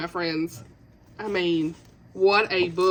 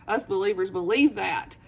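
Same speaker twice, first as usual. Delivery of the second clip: usual speaking voice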